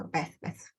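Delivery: Beth ending in f, as "Bef"; th-fronting. Shown as a demonstration. Lecturer showing a Cockney feature, th-fronting. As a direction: say Beth with a final f, quietly. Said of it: "you have f instead of".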